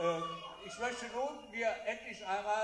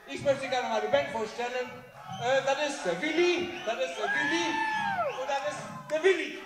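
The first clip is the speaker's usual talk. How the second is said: put-on drunken shouting; 9 semitones higher, 5 dB louder than usual. Drunk compere introduces band.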